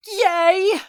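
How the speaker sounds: high, loud squealed cheer; loud, drawn-out happy squeal. Acted. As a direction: burst out in girlish delight. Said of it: "Myra cheers".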